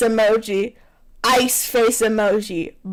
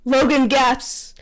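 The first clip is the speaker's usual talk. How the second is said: ordinary talk, nothing marked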